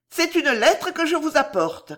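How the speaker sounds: voix de comtesse plus aiguë; comtesse sûre d'elle. A narrator's reading. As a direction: annoncer d'une voix haut perchée, avec assurance. Comtesse sûre d'elle annonce la lettre. (comtesse pleine d'assurance, ton aigu)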